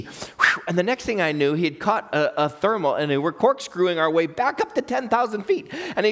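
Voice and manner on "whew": breathy exhale of relief; relieved whew. Retold or imitated. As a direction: blow out air like the danger just passed. Relief after the scary descent.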